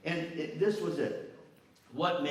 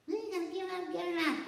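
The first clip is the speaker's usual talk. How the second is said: much higher, put-on voice of a nagging teacher; mock witch teacher. Miss Sellers nagging a med student, witchy mock voice. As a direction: cackle it like a witch on the shoulder.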